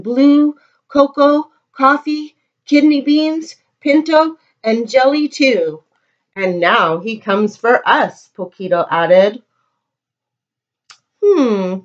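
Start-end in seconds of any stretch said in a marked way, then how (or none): none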